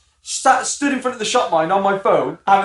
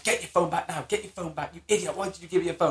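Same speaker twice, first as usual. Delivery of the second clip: annoyed mum, muttered; 10 dB quieter than usual. Retold or imitated. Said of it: "my mum was getting annoyed".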